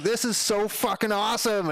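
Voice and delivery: high, gushing delight; giddy excited stranger; thrilled. Retold or imitated. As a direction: rave like a fan who found treasure. Delivery guy thrilled.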